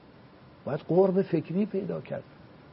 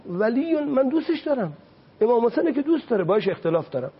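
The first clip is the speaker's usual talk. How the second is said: higher, quick, self-justifying mock voice; mimicking a grudging believer; 7 semitones higher than usual. Speaker imitating a grudging believer to the host, mock self-justifying. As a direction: play the person excusing his grudge. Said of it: "بنابراین ما میگیم".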